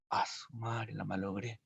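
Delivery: soft, low groan of dismay; muttered dismay. Acted. García Márquez seeing his error, muttered dismay. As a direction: mutter it as you realize the mistake. Author realizing mistake.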